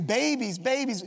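usual speaking voice

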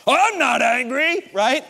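loud, high, drawn-out mock denial; hammed-up defensive outburst; angry denial. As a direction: protest it loudly, clearly angry. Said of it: "what happens when that happens".

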